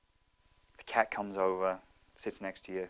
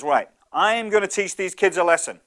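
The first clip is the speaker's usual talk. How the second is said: determined dad; 7 semitones higher, 8 dB louder than usual. Acted. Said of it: "he says".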